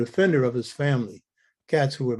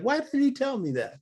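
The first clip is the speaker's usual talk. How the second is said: much higher-pitched retold protest; mother's surprised question; 11 semitones higher than usual. Mother reacting to son's news after father died, surprised and high-pitched.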